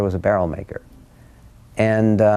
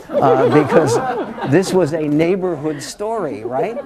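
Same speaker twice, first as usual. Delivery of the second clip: laughing through the words; 9 semitones higher than usual. Real, not acted. Grandson laughing at the family nickname story. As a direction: chuckle as you tell it.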